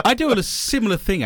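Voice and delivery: ordinary delivery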